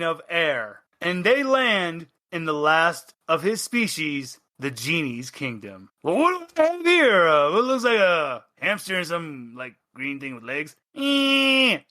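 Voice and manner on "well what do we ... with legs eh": a haughty magical genie. Acted. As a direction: play a grand genie peering down at tiny guests. GM voices the genie.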